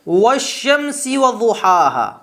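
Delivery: والشمس وضحاها recited slowly with the hamza dropped, read as washamsi waduhaha; Quranic recitation. Shown as a demonstration. Teacher reciting a Quran verse to show the hamza dropping. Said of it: "ہم کہتے ہیں".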